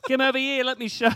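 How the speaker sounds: high-pitched, loud, hammed-up Australian; Australian accent. Shown as a demonstration. Performing on his wife's request, high-pitched mock Australian accent. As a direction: push the pitch right up for a cartoon Australian holler. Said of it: "Please do Australian".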